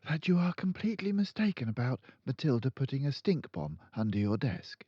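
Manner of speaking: put-on high, polite young teacher; high pleading teacher; timid teacher. A narrator's reading. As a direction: stand firm though nervous. Miss Honey protests.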